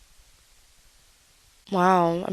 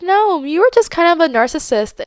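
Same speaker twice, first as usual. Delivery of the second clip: sassy retort; 10 semitones higher, 4 dB louder than usual. Retold or imitated. Retorting to the jealous guy.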